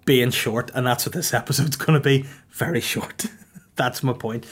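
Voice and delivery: ordinary delivery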